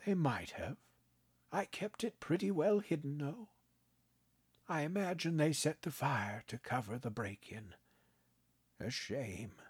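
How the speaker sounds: put-on a kindly old man, gently amused; old grandpa voice. A narrator's reading. Grandpa teasing his grandson, kindly old voice.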